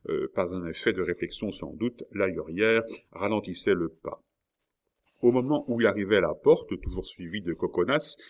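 usual speaking voice

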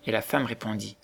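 plain reading voice

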